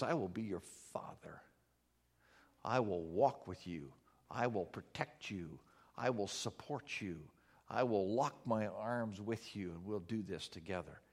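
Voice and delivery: soft, hushed, almost whispered promise; quiet, gentle voice of God as a father; tender fatherly promise. Pretend. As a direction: speak it like a father reassuring his child, hushed. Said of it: "He says".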